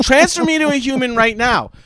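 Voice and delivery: mock angry demand. Demanding a human agent.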